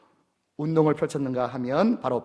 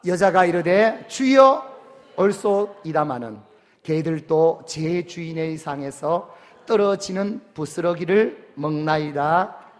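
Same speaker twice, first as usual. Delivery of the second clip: scripture reading; 5 dB louder, slower than usual. Acted. Reading the woman's confession aloud.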